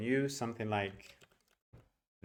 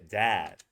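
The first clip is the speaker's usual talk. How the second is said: "dad" drawn out with a big open vowel; 3 semitones lower, 6 dB louder than usual. Shown as a demonstration. Teacher contrasting English with papa, long stressed vowel in "dad". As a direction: stretch the vowel in "dad" slowly. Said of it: "You see how big that is".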